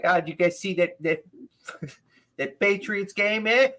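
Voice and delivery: high-pitched mock Boston accent; the Bostonian. Mimicking the comic asking the crowd about the game, Boston accent. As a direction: imitate a Boston comic, laugh breaking in. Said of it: "I'm probably doing his voice worse".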